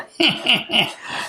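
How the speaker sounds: mimicked snickering, kept fairly soft; mocking laugh. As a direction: imitate him laughing at you. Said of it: "I can hear him going".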